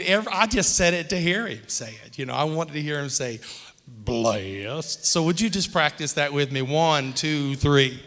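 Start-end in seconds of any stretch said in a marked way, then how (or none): 3.9-5.1 low, chin-tucked drawl rising at the end; churchman impersonation. Retold or imitated. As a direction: pull the chin in and mimic him deeply. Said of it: "You gotta pull your chin in real good".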